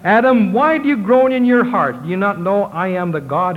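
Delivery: high, questioning voice of the creator God. Acted. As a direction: speak as the Lord questioning Adam. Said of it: "stood before us and said to us".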